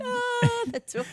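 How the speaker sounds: high, loud mock wail; hammed-up high terror; imitated panicked scream. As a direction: cry out like a trapped movie victim. Retold scream, likened to Aliens.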